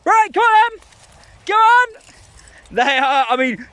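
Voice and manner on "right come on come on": higher, bright call to the dogs; rally them, come on!; eager dog-release call; excited call. Real, not acted.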